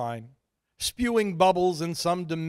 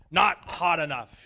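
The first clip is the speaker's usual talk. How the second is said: bellowed; 2 semitones higher, 6 dB louder than usual. A narrator's reading. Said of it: "he bellowed".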